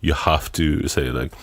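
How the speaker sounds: ordinary delivery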